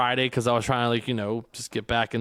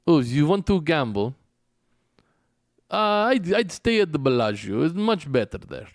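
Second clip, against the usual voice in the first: put-on Russian accent; 3 semitones higher than usual. Russian driver quoted.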